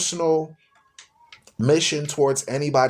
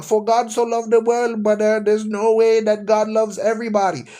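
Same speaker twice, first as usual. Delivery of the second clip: higher, mock preachy quoting voice; mocking a preacher dude; 6 semitones higher than usual. Mocking the Filipino dude who argues him down, higher and preachy. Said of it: "I'll get a Filipino dude come and argue me down".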